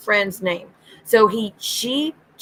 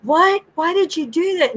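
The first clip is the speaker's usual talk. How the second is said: high, shocked what; a mock high gasp of disbelief; mock shocked Jen; 8 semitones higher than usual. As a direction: imitate Jen's outrage. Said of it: "And Jen's like".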